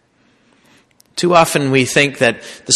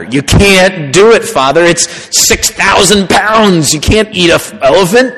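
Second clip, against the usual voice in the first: exasperated students; 6 semitones higher, 4 dB louder than usual. Retold or imitated. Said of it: "they just get all in a lather".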